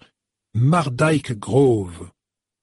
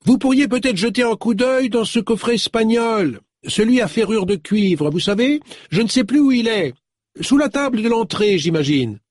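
high-pitched call up the stairs; 10 semitones higher than usual. Acted. Novelist calls up the staircase to her helper, calling out. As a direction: project it high, as if to another floor. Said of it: "cria dans l'escalier".

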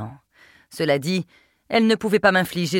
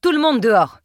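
louder, higher command to a room; forceful, raised-voice order; 6 semitones higher, 6 dB louder than usual. A narrator's reading. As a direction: clear everyone out. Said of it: "ordonnai-je".

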